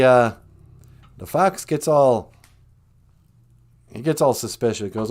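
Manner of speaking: plain reading voice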